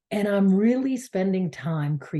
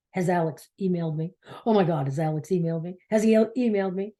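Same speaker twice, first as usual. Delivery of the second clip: quicker and quicker, wound up over the missing email; anxious checking; much faster than usual. Retold or imitated. A woman checking her phone for an email, growing frantic and rushed.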